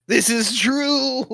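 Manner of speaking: high, loud, drawn-out agreement; emphatic comic admission; exaggerated concession. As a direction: stretch it out and push it up high. Repeats own concession, exaggerated.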